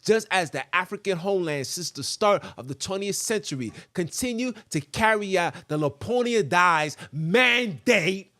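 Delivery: usual speaking voice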